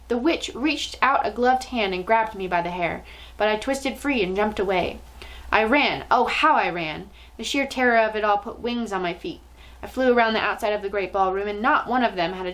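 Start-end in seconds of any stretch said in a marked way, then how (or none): none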